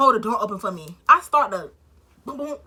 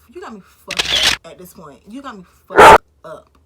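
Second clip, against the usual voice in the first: loud fed-up yell; loud angry rant rising; 18 dB louder than usual. Retold or imitated. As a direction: shout it out, done with him. Furious over his rudeness.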